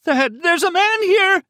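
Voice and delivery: very high, slow, full scream. Acted. Wounded woman screams into telephone for help, screaming. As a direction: scream it in terror. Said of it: "she screamed into the telephone".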